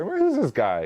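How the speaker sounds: high, puzzled imitation of his wife; mock bewildered. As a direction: pitch up like she's thrown by him. Wife reacting to him.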